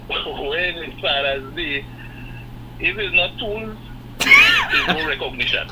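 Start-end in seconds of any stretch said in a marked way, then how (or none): 4.2-5.7 laughing exclaim. Real, not acted. Caller laughing at injustice.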